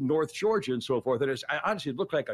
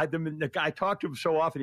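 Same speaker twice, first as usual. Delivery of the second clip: ordinary talk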